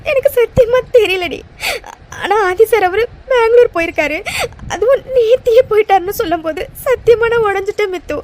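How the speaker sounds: sobbing words out to a friend; crying to her friend about Arjun. Acted. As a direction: let the tears break each phrase. Holds crying throughout.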